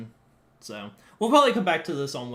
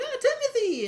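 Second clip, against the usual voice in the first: put-on much higher kid voice calling out. Imagined kid calling dad Timothy, high childlike. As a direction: call like a little kid. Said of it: "they're like".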